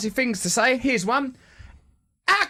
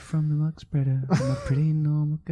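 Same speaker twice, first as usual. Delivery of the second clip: whispery; 4 semitones lower than usual. Acted. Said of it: "it's a bit too whispery".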